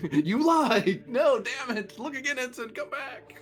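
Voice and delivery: furious captain yelling after him; angry captain. Acted. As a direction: bark at the ensign.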